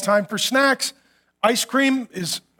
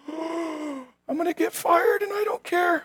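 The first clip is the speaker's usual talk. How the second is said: giddy hushed aside; 6 semitones higher, 6 dB quieter, slower than usual. Retold or imitated. Thrilled whispered aside.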